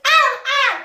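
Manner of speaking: high, loud gull squawks; seagull. Shown as a demonstration. Coach mixing head and nose, gull cry. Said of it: "ça fait la mouette".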